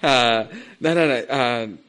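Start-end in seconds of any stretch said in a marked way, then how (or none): none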